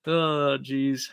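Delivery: ordinary talk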